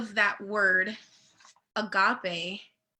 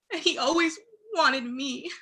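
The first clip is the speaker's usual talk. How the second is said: real crying, voice higher and choked; fighting back tears on every word; telling how God's love moved her, on the verge of tears; tearful; 5 semitones higher than usual. Real, not acted. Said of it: "I'm getting emotional talking about this".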